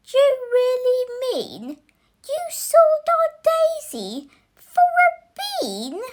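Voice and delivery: high, outraged mother's cry; shrieking mother. Acted.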